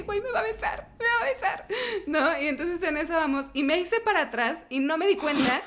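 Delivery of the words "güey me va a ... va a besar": high, breathless panicked giggle; nervous panic. Retold or imitated. Panicking as she tells friends he was about to kiss her. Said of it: "estaba yo extremadamente nerviosa".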